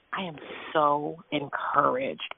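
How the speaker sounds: dreamy relief. Retold or imitated. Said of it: "I came out of there like".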